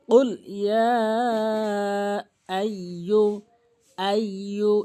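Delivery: slow tajweed, "ইয়া" held long; model recitation. Shown as a demonstration. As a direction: recite "কুল ইয়া আইয়ু" slowly, stretching the aa. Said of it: "এভাবে উচ্চারণ করব".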